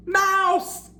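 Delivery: high panicked shriek; panicked cry. Acted. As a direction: elephant terrified of a mouse. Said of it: "cried the elephant".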